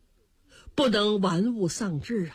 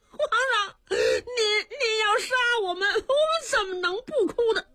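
tearful plea; 11 semitones higher than usual. Acted. Dwarf weeps under sword.

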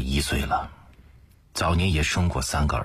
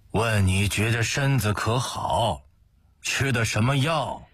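father's question pitched up to get through; insistent repeat; 6 semitones higher than usual. A narrator's reading. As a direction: repeat it higher for an inattentive listener. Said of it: "又重复了一遍".

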